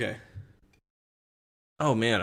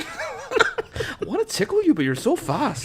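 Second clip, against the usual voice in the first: put-on slow, high echo of a Canadian accent; mimicked Canadian accent; 14 semitones higher, slower than usual. Friend echoing the card, high Canadian accent.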